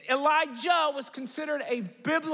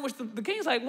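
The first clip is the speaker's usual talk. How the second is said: ordinary delivery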